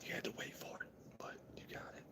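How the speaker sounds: teasing ASMR whisper; mock ASMR whisper. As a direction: breathe it close like an ASMR clip. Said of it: "it's not about A S M R".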